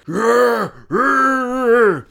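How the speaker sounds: loud, high mock savage roars; imitated roar. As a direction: roar like a new cave leader in jest. New leader roars.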